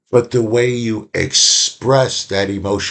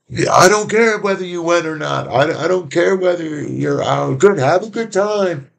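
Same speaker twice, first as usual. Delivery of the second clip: much higher, mock-defiant rant at an empty room; man keeps venting after his partner leaves, higher mock defiance; 8 semitones higher than usual. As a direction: pretend not to care after the partner leaves. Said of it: "they'll still".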